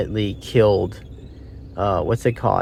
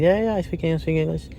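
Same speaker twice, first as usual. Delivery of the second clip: higher, pleading mock voice; a captive pleading for his life, higher and scared; imitating a scared captive; 6 semitones higher than usual. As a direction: beg like a frightened prisoner. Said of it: "He's crying".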